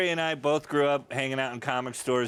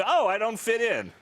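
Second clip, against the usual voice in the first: put-on whiny kid voice; 9 semitones higher than usual. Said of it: "I went".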